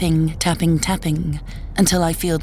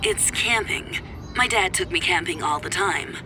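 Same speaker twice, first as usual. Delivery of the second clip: softer, filtered ex-husband on the phone; tinny phone voice; 5 dB quieter than usual. Acted. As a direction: argue down a thin phone line. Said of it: "a tinny voice coming from my phone".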